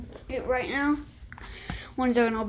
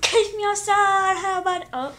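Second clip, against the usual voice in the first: high, loud put-on meme voice; 7 semitones higher, 6 dB louder than usual. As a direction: quote the meme in a silly voice.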